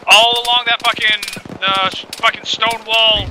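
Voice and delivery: urgent callout. Real, not acted. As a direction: shout the position over gunfire. Calling out enemy position in combat.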